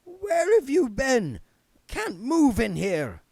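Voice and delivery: a put-upon ghost complaining; reedy petulant ghost. Acted. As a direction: spirit guide snapping at Missus Cake, petulant. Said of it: "A reedy and petulant voice".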